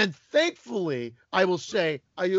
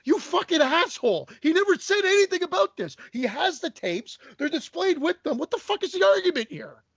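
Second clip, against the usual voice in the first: higher-pitched, indignant rant at the collector; reliving the outrage, voice climbing higher; mock furious retort, higher pitch; retold angry rant; 8 semitones higher than usual. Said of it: "And I'm like".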